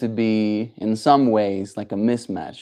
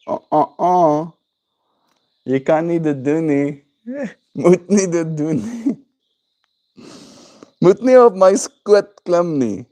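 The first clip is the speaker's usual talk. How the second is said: high silly made-up babble; giggling, pitched-up doggy babble; baby talk to dog; 6 semitones higher, 4 dB louder than usual. Pretend. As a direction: coo nonsense words at the dog.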